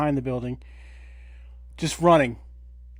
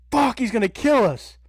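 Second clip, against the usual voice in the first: high, louder panicked line; panicked cry, pitched up; retold panic; 7 semitones higher, 4 dB louder than usual. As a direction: relive the fear of the naked ex. Retold panic as window smashed.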